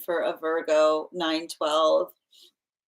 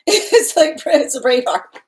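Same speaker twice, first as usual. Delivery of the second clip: laughing; 10 semitones higher, 7 dB louder than usual. Real, not acted. Laughing about Sag and Leo.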